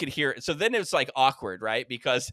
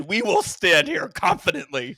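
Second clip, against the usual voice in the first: mock confident; 4 semitones higher, 5 dB louder than usual. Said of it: "And I said".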